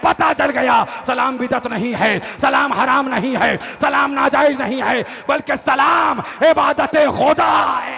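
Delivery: loud, high, hammering preacher's climax; triumphant full-voice declamation; defiant shouted rebuke. Acted. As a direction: throw each argument in the leader's face. Acted confrontation at sermon climax.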